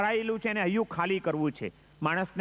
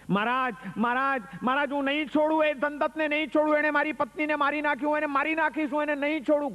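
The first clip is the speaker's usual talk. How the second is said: enraged outburst; 9 semitones higher than usual. Acted. Grieving bandit raging at the monk about his wife's killer, furious. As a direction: spit out the threat in a fury. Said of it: "ગુસ્સામાં આવીને બોલવા માંડ્યો".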